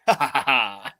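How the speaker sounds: hammed-up evil laugh; villain laugh. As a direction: cackle like an anime villain. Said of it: "and then he goes".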